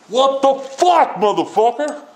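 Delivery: high, slow, outraged shout. Real, not acted. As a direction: yell it in disbelief. Ranting at hypocrite.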